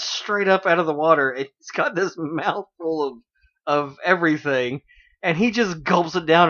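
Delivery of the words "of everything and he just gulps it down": normal talking voice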